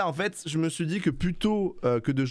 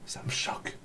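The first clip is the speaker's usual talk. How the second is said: voiceless whisper; hushed, breath-only remark; 4 dB quieter than usual. Real, not acted. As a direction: whisper it close to the microphone. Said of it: "ça doit faire bizarre que je chuchote".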